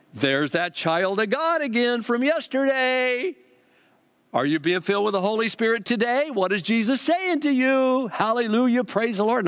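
gushing church lady. Retold or imitated. Bookstore lady greeting the returning customer, high gushing. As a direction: coo it like the overly sweet bookstore lady. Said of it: "here she comes".

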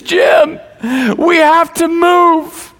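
imitating a distraught wife, high and drawn out; high-pitched mock-tearful cry; retold tearful plea. Pregnant wife phones her husband in tears, imitated crying plea. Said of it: "she's crying. She's in tears".

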